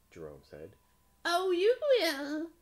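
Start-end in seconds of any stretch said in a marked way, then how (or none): 1.3-2.5 very high, loud, drawn-out witch. A narrator's reading. Witch fooling the frog, high loud witch voice. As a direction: squeal it slowly as a witch.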